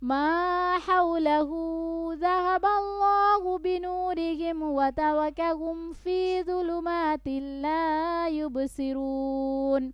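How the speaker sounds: high, slow melodic recitation; Quran recitation. Shown as a demonstration. Teacher recites the passage for students to follow. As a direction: chant slowly and lift the long notes.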